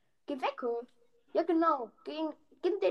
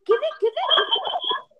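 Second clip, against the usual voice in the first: much higher, slow laughing yell; laughing shout; 4 dB louder, slower than usual. Real, not acted. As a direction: shriek it out laughing. Panicked shouting at enemy.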